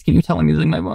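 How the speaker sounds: real strained voice cracking off mid-word; words breaking as the voice fails; voice giving out. Real, not acted. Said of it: "I'm losing my voice".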